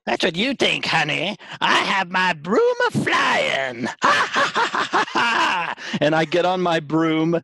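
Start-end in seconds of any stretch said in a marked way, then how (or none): 0.0-3.9 put-on gnarled old hag. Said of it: "now I am a gnarled old hag". 4.0-5.7 very high hag cackle, falling in pitch; witch cackle. Acted. Hag cackles on her broom.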